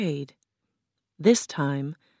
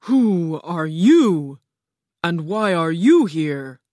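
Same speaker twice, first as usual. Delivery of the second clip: slow, louder demanding enchantress; commanding Oz; 5 dB louder, slower than usual. A narrator's reading. Oz questions the Scarecrow from her throne, imperious.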